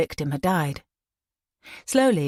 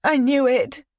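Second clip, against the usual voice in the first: put-on higher, upset young woman; distressed; 8 semitones higher, 4 dB louder than usual. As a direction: close to tears. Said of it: "The girl let out a low whimper".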